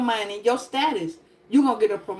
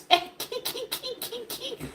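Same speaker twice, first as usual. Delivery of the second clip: high squeaky giggle; quick high kiki laugh; 10 semitones higher, 10 dB quieter than usual. Real, not acted. Comic laughing at her own story, high giggle.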